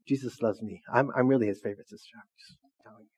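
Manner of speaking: mock humblebrag, low and tossed off; arrogant. Preacher mimicking an arrogant believer at work, low smugness. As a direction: imitate a smug believer, voice dropped. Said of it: "walk around arrogant".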